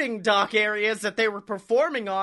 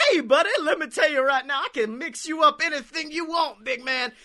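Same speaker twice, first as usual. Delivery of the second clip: a hint of a slick bartender, higher and fast, laughing through it; sly bartender; 6 semitones higher than usual. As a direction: play the smooth guy who can mix anything, half laughing. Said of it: "he's just got this sly, like, Pulp Fiction motherfucker".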